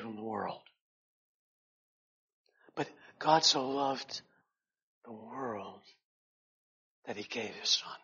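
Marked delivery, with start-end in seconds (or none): 2.7-8.0 hushed correction. Shown as a demonstration. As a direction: breathe out the true verse gently, in awe. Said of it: "we would think ... that it would read".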